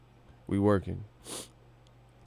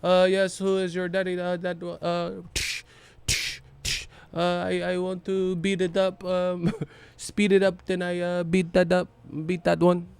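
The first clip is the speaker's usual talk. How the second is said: put-on higher, soft Filipino accent with slow hesitant uhs; Pacquiao impression; 7 semitones higher, 4 dB quieter than usual. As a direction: bedroom talk in a hammed-up Pacquiao accent. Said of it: "with the Pacquiao accent".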